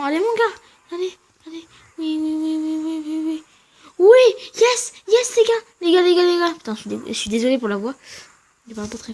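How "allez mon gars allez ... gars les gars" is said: loud, high shouting of yes; real triumphant yelling at the screen; victory cheer. Real, not acted. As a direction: cheer the win at full voice. Cheering as the match is won.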